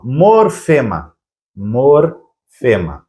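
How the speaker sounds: "morfema" drawn out slowly, part by part; teacherly slow-motion "morfema", with a gap after "mor"; slow, split word. Shown as a demonstration. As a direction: stretch "morfema" into "mor... fema". Teacher breaking down a new term.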